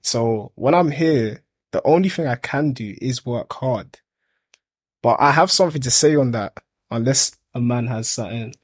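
ordinary talk, nothing marked

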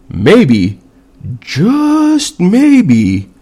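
drawn-out, high-pitched "just maybe"; pointed coaxing. Real, not acted. Telling stubborn veterans to admit it, pointedly coaxing.

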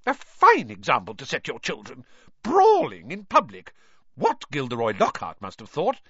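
mother scolding her husband for brawling; furious scolding. Acted. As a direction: tear into them like a furious mother. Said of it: "beside herself with fury".

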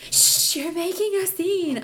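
slow, high-pitched mock scolding; shush, then chide, imitating an embarrassed companion; drawn-out retold hushing; imitated shushing reproach. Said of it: "He's like".

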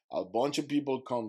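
ordinary delivery

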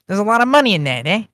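high-pitched Italian-accented catchphrase; mock Italian accent. Shown as a demonstration. Showing Miles the one line, hammed-up Italian accent. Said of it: "All you gotta do is say one line".

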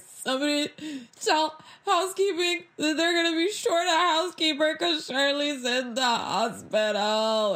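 high, tearful announcement. Retold or imitated. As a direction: blurt it out close to tears. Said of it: "crying again".

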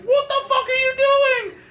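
high, loud shocked yell from the counselor; mock outraged adult yell. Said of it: "counselor came over and was like".

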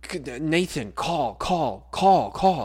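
high, frantic stammer climbing to a loud cry; panicked colleague who can't get the words out. Retold or imitated. Colleague stumbling over words, frantic high stammer. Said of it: "stumbling over her words like crazy".

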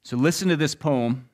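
ordinary talk, nothing marked

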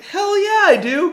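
excited. Retold or imitated. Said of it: "I'm like".

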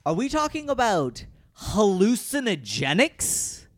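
rising, slowing, incredulous questions; mounting surprise. Real, not acted. Escalating mock shock.

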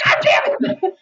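very high voiceless cry; exasperated cry. Retold or imitated. Actress fed up at being recognised, a high breathy cry. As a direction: throw up your hands with a high breathy cry. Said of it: "She's like".

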